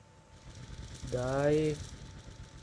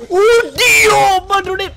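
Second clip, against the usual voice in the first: higher, louder startled oddio; alarmed cry; 9 semitones higher, 5 dB louder than usual. Real, not acted. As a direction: jolt of alarm. Sudden gamer alarm. (grido di spavento)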